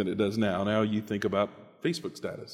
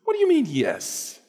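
much higher, outraged wife; indignant wife. Acted. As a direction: squeal in shocked outrage. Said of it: "And she was indignant".

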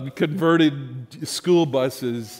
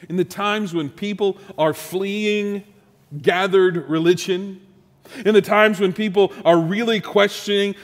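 higher-pitched, building preacher's proclamation; impassioned preaching; 4 semitones higher, 5 dB louder than usual. Real, not acted. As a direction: preach it with rising force.

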